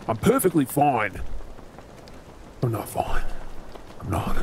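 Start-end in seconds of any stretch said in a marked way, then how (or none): 2.6-4.4 whispered under his breath, barely voiced; hushed whisper. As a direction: breathe it so the crew can't hear. Hides wound from crew.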